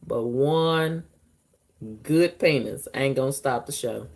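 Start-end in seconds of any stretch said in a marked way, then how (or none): none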